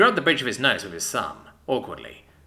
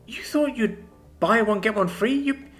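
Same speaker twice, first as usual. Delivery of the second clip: high, slow, scandalised; outraged girlfriend calling him a pig; indignant outburst; 12 semitones higher, slower than usual. A narrator's reading. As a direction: throw the accusation at him, slowly. Alison finds out he's jealous.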